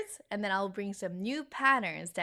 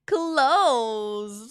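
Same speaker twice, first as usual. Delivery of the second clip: announcing; 2 semitones higher, 9 dB louder than usual. Shown as a demonstration. Announcing new chapter topic.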